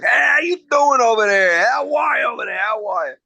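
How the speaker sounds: loud, high-pitched New York greeting; New York accent. Retold or imitated. Singer imitating father and uncle, hollered New York accent. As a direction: holler it like a boisterous New Yorker. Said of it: "They had a New York accent".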